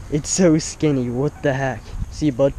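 ordinary talk

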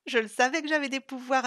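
crowing with a wide smile; big grin. Acted. Sylvie gloating to Isa and Vlad, beaming. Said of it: "affirma avec un grand sourire Sylvie".

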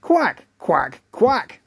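three duck quacks; duck quacking. Acted. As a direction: answer only in quacks. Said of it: "went Egg".

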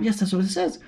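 ordinary delivery

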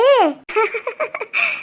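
short soft giggle; light laugh. Real, not acted. Aunt laughs softly at the newborn.

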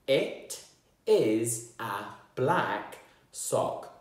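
each word slow and separate, tapped out. Shown as a demonstration. Teacher tapping shoulders on each word for pupils, slow. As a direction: beat out every word slowly. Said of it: "tap my shoulders and say that".

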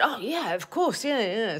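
hammed-up British accent, eager to agree; put-on British accent. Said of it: "thought I was using a British accent".

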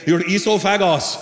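Indian-accented dad, lightly imitated; dad's Indian accent. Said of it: "as my dad would say".

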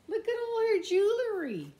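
high, delighted exclaim; excited. Real, not acted. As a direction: point it out with glee. Delighted aside to children.

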